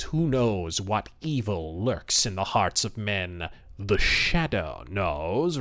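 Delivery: low, slow, ominous radio-villain intonation; menacing as the Shadow, drawn out; Shadow catchphrase. Retold or imitated. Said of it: "the immortal catchphrase".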